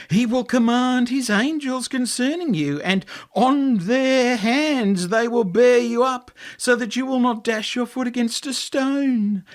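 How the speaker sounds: high, mocking tempter voice; taunting devil. A narrator's reading. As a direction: sneer and goad him from above.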